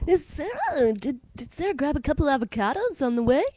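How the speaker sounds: put-on chatty Ottawa-suburb accent; breezy Stittsville accent. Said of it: "The Stittsville accent".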